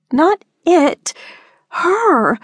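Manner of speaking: put-on pleading teen correcting her mother; teen daughter, protesting. A narrator's reading. Daughter Jordan voiced by narrator.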